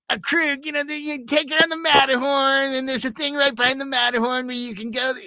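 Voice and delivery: high, eager rambling voicemail; Ralph's voicemail. Retold or imitated. Voicemail from coworker about Disneyland tips, high and eager. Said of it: "And you, boop".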